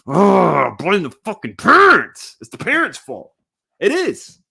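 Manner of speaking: loud, high exasperated yell; angry outburst. Real, not acted. Fed-up podcaster blaming the parents, angry outburst. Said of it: "I'm very angry".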